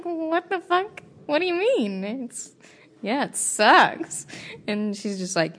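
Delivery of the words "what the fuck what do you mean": disbelief pitched way up; hearing a drunk woman's question, high and disbelieving; incredulous. Retold or imitated. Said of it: "I'm like".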